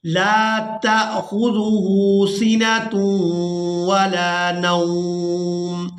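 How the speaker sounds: slow, melodic tilawat, drawn out; Quran recitation. Shown as a demonstration. Teacher reciting the verse to students, slow sung tilawat. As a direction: chant each word long and sung.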